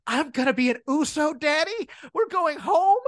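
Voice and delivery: high, eager puppy-dog voice, imitated; mock an overeager kid pleading with Daddy, pitch climbing; wide-eyed puppy dog. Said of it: "gets the most wide-eyed puppy dog".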